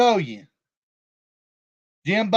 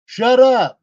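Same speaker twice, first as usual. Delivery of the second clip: a loud, snapped "Shut up."; retold shout; 4 semitones higher, 6 dB louder than usual. Quoting his own text to Jimbo, loud. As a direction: bark it the way you texted it. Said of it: "I said".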